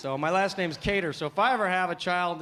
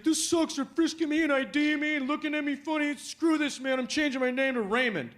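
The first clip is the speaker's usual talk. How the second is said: higher, fed-up cousin voice; annoyed cousin; 9 semitones higher than usual. Retold or imitated. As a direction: whine about the airport checks. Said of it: "he's like".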